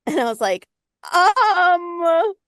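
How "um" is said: high, loud stalled um; awkward hesitant um. Retold or imitated. Hesitating at faith question.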